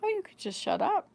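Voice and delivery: imitating a light, high-pitched suggestion; calm, matter-of-fact. Son answering mother, retold as innocently matter-of-fact. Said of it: "he said it and just".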